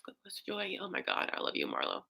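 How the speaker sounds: choked up, barely getting the words out; whispered through tears; tearful thanks. Real, not acted. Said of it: "I'm just, like, overwhelmed".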